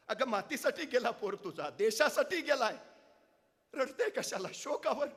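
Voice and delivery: high, loud urging comfort; king begging a mother not to grieve; consoling king. Acted. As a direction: plead with her to stop weeping. Raje consoles the weeping mother.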